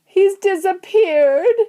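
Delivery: very high, drawn-out wail; loud, slow, high-pitched cry; yell. A narrator's reading. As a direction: yell it in panic. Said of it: "yelled Missus Gloop".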